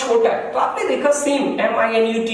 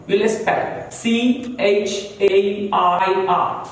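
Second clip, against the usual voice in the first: letters spelled out very slowly; slow spelling; much slower than usual. Shown as a demonstration. Teacher spelling chair letter by letter. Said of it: "हम पहले spell करेंगे".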